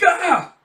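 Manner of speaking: very high, loud mock yelp; hammed-up shriek; startled scream. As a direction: jump at the sudden ring. Startled by phone ringing.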